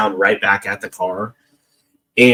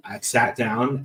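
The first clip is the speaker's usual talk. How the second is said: normal talking voice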